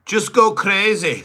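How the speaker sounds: high loud mock coach yell. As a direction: imitate the coach hyping him. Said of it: "And his coach said".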